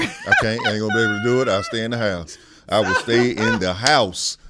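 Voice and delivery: loud, high-pitched protest about the cold. Real, not acted. Texas boy loudly refusing the freezing cold, protesting. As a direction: cry out that you won't go out.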